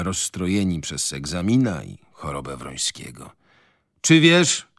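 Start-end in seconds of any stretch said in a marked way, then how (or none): 4.0-4.7 high, loud indignant cry; calling out. A narrator's reading. Father rebuking his son, calling out. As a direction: demand it loudly, pitch raised. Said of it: "wołał pan Bielecki".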